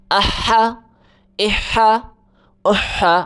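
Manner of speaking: اہا with a hard h pushed from the throat. Shown as a demonstration. Teacher showing pupils the throaty ح sound in اہا. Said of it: "حلق سے آواز نکال کے پڑھیں".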